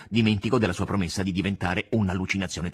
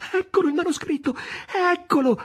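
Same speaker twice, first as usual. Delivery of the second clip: very high, moved exclamation; tearful cry; 3 dB louder than usual. A narrator's reading. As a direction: cry out, overcome to the point of tears. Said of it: "gridò, commuovendosi di nuovo fino alle lacrime".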